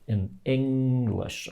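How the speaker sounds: "English" with a drawn-out first syllable; lengthened stressed syllable. Shown as a demonstration. Teacher showing English stress, long first syllable in "English". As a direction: stretch the Eng of "English" slowly. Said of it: "I say it a little bit longer".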